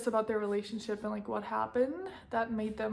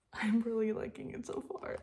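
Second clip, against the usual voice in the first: whispering. Real, not acted. Late night, quiet update.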